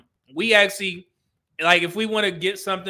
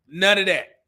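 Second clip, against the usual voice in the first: ordinary talk, nothing marked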